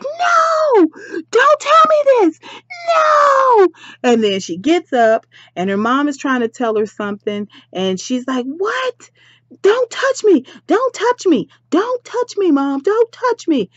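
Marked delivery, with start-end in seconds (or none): 0.0-3.7 very high, loud wail of denial; distraught shout. Acted. Duchess hears of a fatal crash, distraught shouting. 8.5-13.7 high-pitched hysterical protest; frantic. Acted. Duchess pushes her mom away, frantic. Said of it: "She's frantic".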